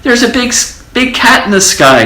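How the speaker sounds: high, stammering scared mouse; telling mother of the cat; frightened cry. A narrator's reading. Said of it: "cried the little mouse".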